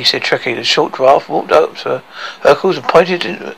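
plain reading voice

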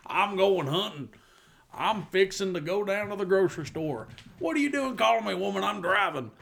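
playfully imitating a Southern husband; mock Southern accent. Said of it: "which is a very Southern thing".

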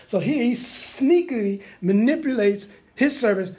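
ordinary talk, nothing marked

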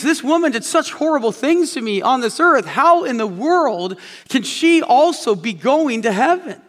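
mild mock outrage at the woman's fate; growing anger. Man resenting the woman who wronged him, indignant. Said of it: "he begins to feel this anger build up within him".

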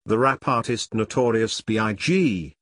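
ordinary talk

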